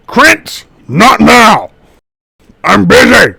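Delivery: high, very loud irritated roar; furious in-character bellow; angry half-orc shout. Acted. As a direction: yell it as a busy brute interrupted. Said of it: "he looks up and he goes".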